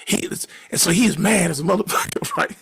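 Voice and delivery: normal talking voice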